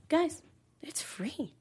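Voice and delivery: slow unvoiced whisper. Podcast host whispering the free plug to listeners, slow whisper. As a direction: breathe it like a secret, slowly.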